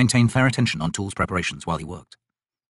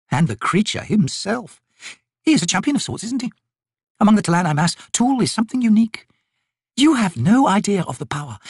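high, emphatic noblewoman's voice; haughty lady, impressed; 9 semitones higher than usual. Acted. Lady extolling Tool's power to the soldier, impressed. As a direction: dwell with relish on each marvel.

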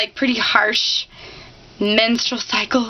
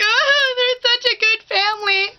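mock sobbing; 10 semitones higher than usual. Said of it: "I'm like".

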